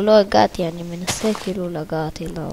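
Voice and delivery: ordinary talk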